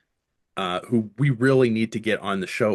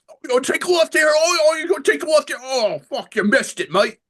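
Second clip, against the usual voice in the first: high, fast, frantic British rocker voice, much higher and louder; a panicky celebrity sat-nav yelping at a missed turn; 5 dB louder than usual. Retold or imitated. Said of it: "why would you want that for your".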